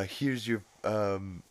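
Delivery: a hint of Stewie's smug menace; mildly mocking Stewie delivery. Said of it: "he's like".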